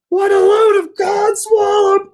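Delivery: very high, slow squealing shout; outraged catfish. Acted. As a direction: cry out in cartoon indignation.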